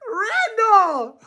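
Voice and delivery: high-pitched cry of disbelief; scandalized high yell; shrieked name. Real, not acted.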